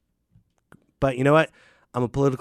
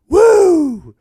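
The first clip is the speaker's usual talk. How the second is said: sudden high loud wrestler's woo; Ric Flair woo; 13 dB louder than usual. Retold or imitated. Podcaster does his one Ric Flair impression, a loud high woo. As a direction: bark out a Ric Flair woo like the real thing. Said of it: "That's how I would write him in".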